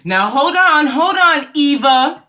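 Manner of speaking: loud, high, hammed-up proclamation; imitating a woman loudly broadcasting her call; mock public announcement. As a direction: announce it to the whole room over the phone. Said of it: "she makes an announcement, basically".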